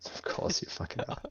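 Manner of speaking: whispered aside under the breath. Real, not acted. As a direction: hiss it to yourself, barely voiced.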